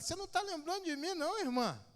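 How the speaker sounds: high, quick woman's voice; the woman asking if he remembers her, high and quick; imitating the woman. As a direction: play her, bright and chatty. Retold woman asks, voiced high.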